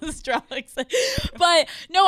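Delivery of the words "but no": laughing. Real, not acted. Laughing at own joke.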